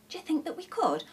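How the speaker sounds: high, hopeful question; gasping wonder. A narrator's reading. Shifty asks the others about running a cafe, gasping wonder. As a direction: ask it in breathless hope. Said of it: "gasped Shifty".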